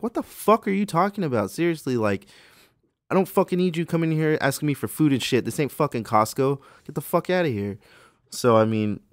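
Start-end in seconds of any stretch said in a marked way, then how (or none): 0.0-7.8 angry outburst, pitched high and loud; indignant inner rant. Acted. Store clerk silently cursing a customer who wants food, indignant rant. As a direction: let rip at a customer in your head.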